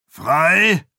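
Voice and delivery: loud, high, scoffing question; incredulous jeer; mocking. A narrator's reading. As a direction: throw the word back in derision. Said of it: "spottete jemand aus Aandens Truppe".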